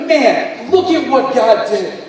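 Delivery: excited praise; joyful exclaim. Pretend. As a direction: cry out in wonder at what God did. Imagined joyful look back.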